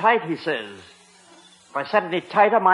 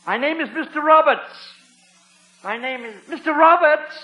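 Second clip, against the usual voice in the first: higher and louder, spelling out his name; speaking louder; 7 semitones higher than usual. Acted. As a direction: raise the voice so the chief hears. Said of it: "Was I speaking too loudly?".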